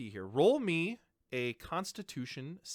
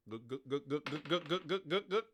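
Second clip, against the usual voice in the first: softer rhythmic gug gug gulps; mock gulping; 2 semitones higher, 4 dB quieter than usual. Dwarf chugs his beer in the contest, mock gulping. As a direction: imitate chugging beer in quick gulps.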